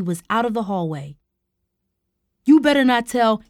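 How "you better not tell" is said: raised, urgent warning; loud threat. A narrator's reading. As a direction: warn them loudly as you're forced out. Uncle threatens while pushed out.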